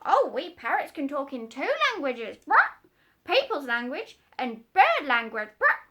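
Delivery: high, loud boastful parrot; proud parrot. Acted. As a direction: show off like a parrot.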